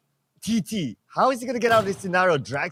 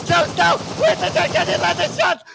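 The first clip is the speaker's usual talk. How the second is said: esports caster; 9 semitones higher, slower than usual. Real, not acted. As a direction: shout the winning play at full hype. Caster calls the final fight.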